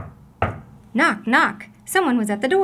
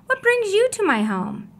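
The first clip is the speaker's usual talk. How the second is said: high surprised question; exclaimed question to the vendor; exclaiming; 10 semitones higher, 3 dB louder than usual. Acted. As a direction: ask with bright surprise. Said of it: "Amu exclaimed".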